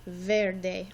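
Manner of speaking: "Verde" said slowly, the E sounding like an A, "vair-day"; Spanish model word. Shown as a demonstration. Teacher modelling "Verde" for her class to repeat. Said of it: "the E actually sounds like an A".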